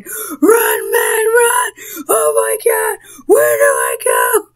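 high, frantic screams of panic; imagined panic while playing a horror game with friends, screaming; mock panicked screaming. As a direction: shriek like you're fleeing a monster. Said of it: "I will be screaming my head off".

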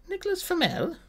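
muffled under blankets. A narrator's reading. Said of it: "her voice muffled by the blankets".

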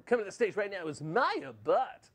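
mock club DJ, stretching the final name; strip club announcer.

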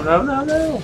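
squeaky high sing-song no, no, no; playful falsetto denial; high mock no. Real, not acted. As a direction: toss off a teasing high-pitched no. Mock protest at opponent's play.

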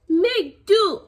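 put-on high, loud toddler echo; baby voice. A narrator's reading. Baby brother echoing mama in high voice. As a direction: squeak it like a baby copying mama. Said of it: "he replied".

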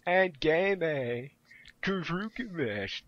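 hammed-up Japanese-accented fan; mock Japanese accent. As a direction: parody an anime-convention ad in fake Japanese accent. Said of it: "like he came out of that".

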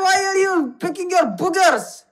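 high-pitched disbelief; appalled scolding. Real, not acted. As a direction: call him out, voice jumping up high. Scolds stranger picking his nose.

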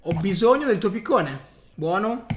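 ordinary talk

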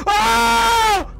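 high startled yell; startled scream. Real, not acted. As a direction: cry out at a sudden fright. Sudden scare.

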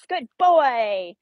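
normal talking voice

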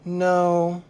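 mildly sad, drawn-out level no; understated sorrow. A narrator's reading. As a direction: hold it on one downcast note. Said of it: "the Ziz said sadly".

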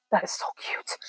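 real whisper, overcome by cuteness; gushing whisper. Real, not acted. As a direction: whisper it like you can't believe it. Gushing over a jacket pocket.

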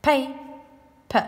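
"Pe" and "p" as two isolated beats; syllables apart. Shown as a demonstration. Teacher splitting the word for pupils, "Pe" and "p" sounded separately. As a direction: say "Pe", pause, then a short "p". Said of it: "we split up the p a".

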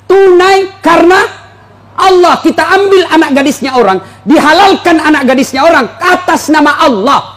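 preacher crying out the marriage vow to the husbands; fervent preaching. Acted. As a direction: build to a ringing climax.

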